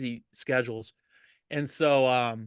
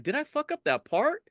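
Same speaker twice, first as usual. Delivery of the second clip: panicked high; 12 semitones higher than usual. Retold or imitated. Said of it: "I'm like".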